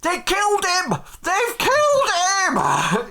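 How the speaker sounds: high, outraged full yell; shout from the crowd. Acted. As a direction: scream the accusation over a crowd. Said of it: "somebody does shout for the".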